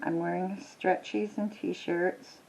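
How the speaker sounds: ordinary talk, nothing marked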